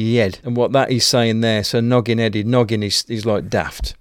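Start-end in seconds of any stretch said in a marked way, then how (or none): 0.0-0.4 head said as yed, with a y and no h. Shown as a demonstration. Dialect speaker explaining local words, yed for head. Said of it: "your head is your yed".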